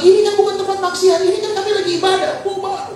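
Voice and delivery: fast, high-pitched scolding; retold shouting-down of a mob; acted indignant protest. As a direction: rush out a protest to intruders, voice way up. Acts himself confronting the mob.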